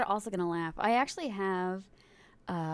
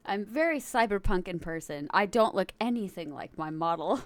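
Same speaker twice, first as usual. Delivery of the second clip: ordinary delivery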